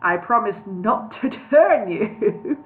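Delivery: slow, laughing through the words; chuckling. Acted. Wizard chuckles while reassuring the hobbit, laughing. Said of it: "chuckling to himself".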